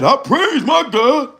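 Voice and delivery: high, slow, drawn-out mock praise; her churchy praise voice. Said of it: "That's how she talked".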